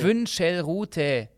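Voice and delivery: „Wünschelrute“ sehr langsam, jede Silbe einzeln; „Wünschelrute“ mühsam zusammensetzen; Silbe für Silbe. Shown as a demonstration. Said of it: "Sag's mal fließend". („Wünschelrute“ ganz langsam und abgehackt gesprochen)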